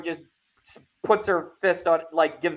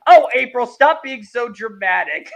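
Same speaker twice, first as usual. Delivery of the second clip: mock chiding; 5 semitones higher, 7 dB louder than usual. Acts out May scolding April.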